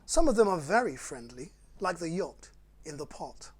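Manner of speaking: lively, sing-song picture-book read; bouncy storytime rhyme reading; reading to children. Acted. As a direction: read it like bedtime fun for little ones. Reads Dr Seuss to children.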